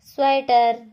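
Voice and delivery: Sweater called out loud and high. Shown as a demonstration. Teacher announcing the picture to children, loud. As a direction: announce the word like a quiz answer. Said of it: "फिर है".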